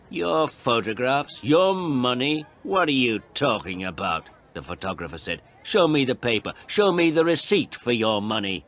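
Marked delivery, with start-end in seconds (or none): 0.1-4.3 slow, drawn-out mocking questions; stretched, raised-pitch scorn; scoffing disbelief. Photographer scoffs at the demand. 5.6-8.6 raised, loud, peremptory order; forceful and dismissive; challenging demand. Acted. Photographer challenges the old man.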